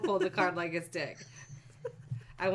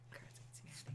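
whisper; 23 dB quieter, much faster than usual. Real, not acted. Whispered aside after song.

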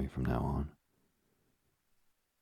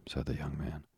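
usual speaking voice

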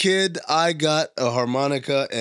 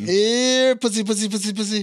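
mock villain taunt, high-pitched; 8 semitones higher than usual.

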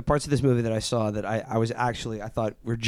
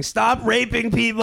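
high, strained mock ranter voice; squeaky hammed-up imitation of a shouting pundit; mock Andrew Breitbart rant; 10 semitones higher than usual. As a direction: parody a red-faced ranting pundit, pitched way up. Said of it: "going around going".

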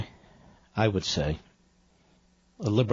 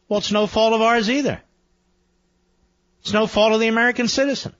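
indignant; 9 semitones higher, 3 dB louder than usual. Real, not acted. Host rants against immigration talking points.

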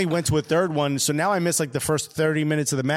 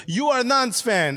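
slow, higher-pitched declaration; excited French fan; 6 semitones higher, slower than usual. Retold or imitated. French fan spotting a yellow jacket tab, slow and excited. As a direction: imitate a foreign fan's slow pronouncement.

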